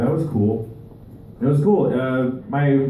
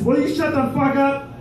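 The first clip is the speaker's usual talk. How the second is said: mock angry retort; 11 semitones higher than usual. Acted quarrel, employer turns on employee.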